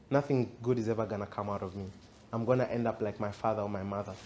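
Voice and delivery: low, soft, quick negative self-talk; self-condemning inner voice. Acted. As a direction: rattle off a defeated thought under your breath. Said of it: "That's the voice you want speaking to you".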